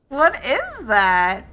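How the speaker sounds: loud, startled question. Real, not acted. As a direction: ask it in sudden alarm. Startled by the clip.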